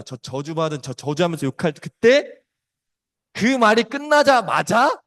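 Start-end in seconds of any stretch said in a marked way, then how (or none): 1.8-4.9 slow, high and loud, building suspense; dramatic loud build. Shown as a demonstration. Preacher building suspense before the rooster crows, slow and loud. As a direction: hold the moment with a loud rising push. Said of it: "dramatic build before rooster crow".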